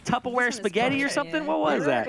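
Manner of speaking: high teasing voice. Pretend. Teasing about eating on stream.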